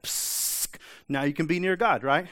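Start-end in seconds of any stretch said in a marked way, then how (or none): 0.0-0.8 long sizzling "psst"; imitated searing hiss. Preacher imitating coal touching unclean lips, sizzling hiss. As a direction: make the sound of a hot coal touching skin.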